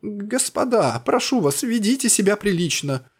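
put-on high, squeaky old man voice; thin squeak. A narrator's reading. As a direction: pipe it up thin and pleading. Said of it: "тоненько пропищал старик". (тоненький писк)

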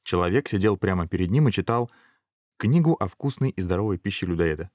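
ordinary delivery, nothing marked

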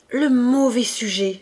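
lent et plus fort, pleine de reproche; exclamation indignée. A narrator's reading. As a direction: condamner le neveu d'un cri. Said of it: "s'écria la tante". (la tante s'écrie, indignée contre Marius)

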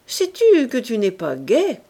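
plain reading voice

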